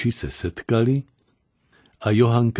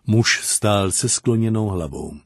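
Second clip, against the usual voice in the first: plain reading voice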